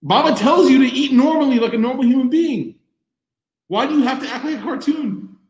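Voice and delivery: high, loud mock telling-off; hammed-up angry rant; exasperated scolding. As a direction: scold him like a fed-up dad at breakfast. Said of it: "I was gonna be like".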